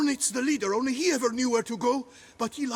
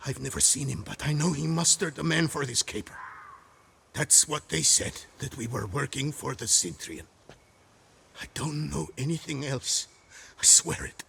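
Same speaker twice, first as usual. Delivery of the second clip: cowed confession; 10 semitones lower than usual. Acted. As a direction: mumble it out, beaten.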